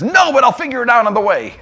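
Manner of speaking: high, eager, heedless reply; gung-ho zeal. Acted. Intern brushing off a question while leaving, gung-ho zeal. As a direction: answer brightly, already rushing off.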